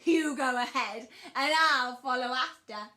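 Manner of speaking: loud monster voice; amused Gruffalo. Acted. Gruffalo agrees to follow.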